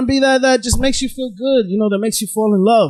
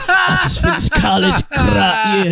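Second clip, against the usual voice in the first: much lower, put-on tough guy voice; fake deep voice; 4 semitones lower than usual. Mocking a friend's fake deep TikTok voice. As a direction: mimic a friend faking a hard Brooklyn voice. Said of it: "he's just talking all weird".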